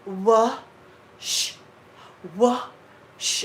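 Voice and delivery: wash drilled as "wosh", no r, with a rising tune; coaching wash. Retold or imitated. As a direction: teach wash without an r, slowly. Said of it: "Say it with me".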